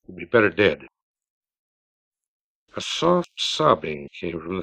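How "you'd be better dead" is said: a mild, lower icy judgement; cold voice. A narrator's reading. Emmeline condemning her sister, cold and final. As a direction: say it coldly, final. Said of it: "the cold voice broke in".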